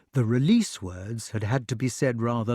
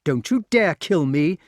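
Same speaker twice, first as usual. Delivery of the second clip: louder defiant cry; shouting boy; 6 semitones higher, 6 dB louder than usual. A narrator's reading. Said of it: "cried the wart".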